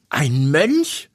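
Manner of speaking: sharp startled question. A narrator's reading. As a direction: blurt it out in alarm. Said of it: "fuhr Walburga auf".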